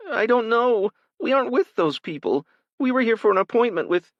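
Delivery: put-on a frightened college girl begging for her life; girl at gunpoint pleading with the attacker, panicked; terrified girl. Terrified girl with gun at her face.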